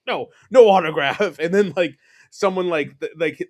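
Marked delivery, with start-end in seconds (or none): 0.1-1.2 irritated refusal. Retold or imitated. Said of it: "he was pissed off".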